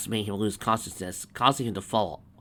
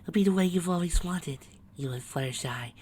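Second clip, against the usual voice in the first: high, airy whisper in the ear; soft angelic coaxing; sweet whisper; 6 semitones higher, 4 dB quieter than usual. A narrator's reading. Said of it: "she whispered in her ear".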